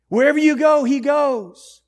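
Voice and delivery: high, loud proclamation; exultant preaching shout. Real, not acted. Preacher assures believers of God's presence, exultant shout. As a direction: shout it out to the whole congregation.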